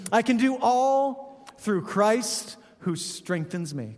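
a slow, drawn-out verse read; slow dramatic recital. Shown as a demonstration. Pastor slowly recites his favorite verse, drawn out. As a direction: savor every word of the verse. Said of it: "This is one of my favorites".

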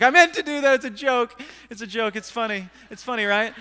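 loud, high insistence, easing off; bluffing excuse. Retold or imitated. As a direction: cover a blunder as a joke, protesting high. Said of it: "like any good pastor, I lie".

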